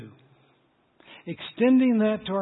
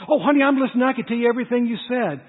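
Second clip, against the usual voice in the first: higher, louder, fast protest; defensive protest; 6 semitones higher, 6 dB louder, faster than usual. Retold or imitated. Husband insisting to his wife he is listening, loud and fast. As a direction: blurt it out defensively.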